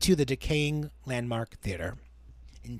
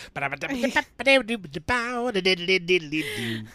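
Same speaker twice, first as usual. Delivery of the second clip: put-on scatted old-time jazz intro; nonsense-syllable podcast music sting; imitated 1930s music; 4 semitones higher than usual. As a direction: mouth a bouncy thirties band tune. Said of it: "music would kick in and be like".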